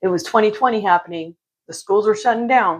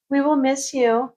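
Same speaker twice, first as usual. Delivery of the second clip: mock sweet; 6 semitones higher, slower than usual. Sister's sugary text reply.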